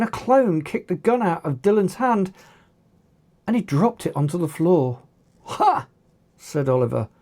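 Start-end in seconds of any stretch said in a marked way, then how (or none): 5.4-5.9 gleeful ha. A narrator's reading. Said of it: "with a look of complete glee".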